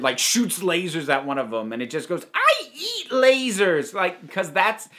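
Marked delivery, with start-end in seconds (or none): 2.3-3.9 high, slow, goofy boast; silly alien voice. Acted. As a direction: crow it like a silly toddler toy. Alien toy brushing off lasers.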